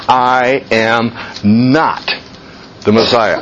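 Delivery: slow, low, deliberate I am not the Messiah; John stating it plainly and slowly; firm slow denial. Retold or imitated. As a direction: lay down each word firmly. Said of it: "No mushiness here".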